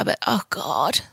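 soft, breathy despairing aside; whispered groan. Real, not acted. Groaning about rain.

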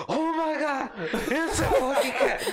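high squealing panic, half laughing; panicked cartoon lady. Big coworker shrieking and fleeing the cat, high panicked squeal. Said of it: "like those fifties cartoons where".

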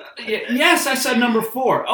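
loud, very high acted exasperation; exasperated customer. Customer repeating an order to the intercom lady, loudly exasperated. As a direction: answer the order-taker at the top of your voice.

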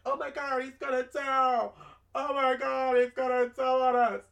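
high, loud panicky squeal; panicked kids. Retold or imitated. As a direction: freak out like scared kids. Kids panicking that he'll tell.

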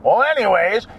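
ordinary talk, nothing marked

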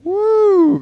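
loud high woo; excited whoop. Real, not acted. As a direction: whoop in bewilderment. Exclaims at confusing idea.

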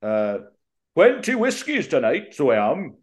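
loud, high-pitched celebrity impression. Pub anecdote quoting a famous singer, boisterous high impression. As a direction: burst out high and loud, hammed-up.

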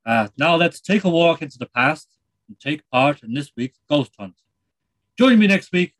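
put-on light Irish accent, chatty presenter; a lightly done Irish TV host; Terry Wogan. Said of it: "It's Terry Wogan here".

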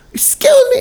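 very high, loud mock shriek; imitation of an outraged woman's screech; affronted old lady. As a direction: squeal it in shocked indignation. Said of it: "And then she goes".

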